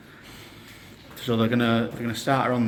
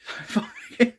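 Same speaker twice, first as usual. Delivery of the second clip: incredulous laugh; 13 semitones higher than usual. Real, not acted. Doctor offers to send her home.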